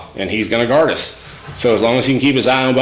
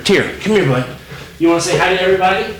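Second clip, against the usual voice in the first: higher, fast sing-song pet talk; coaxing the puppy; 6 semitones higher, faster than usual. Real, not acted. Owner coaxing her puppy toward the camera, high and fast. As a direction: sweet-talk the dog over.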